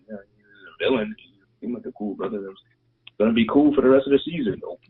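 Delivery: ordinary talk, nothing marked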